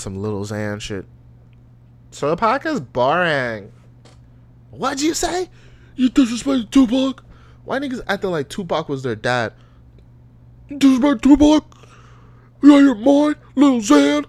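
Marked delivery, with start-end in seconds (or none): 2.1-3.7 mock listener voice. Imitating offended listener. 4.7-14.2 very high, loud outraged fan voice; mock outraged fan. Outraged fan confronting him.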